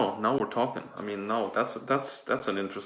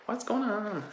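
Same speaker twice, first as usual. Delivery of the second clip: very high, squealing panic; shocked girls; 13 semitones higher than usual. Retold or imitated. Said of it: "They were like".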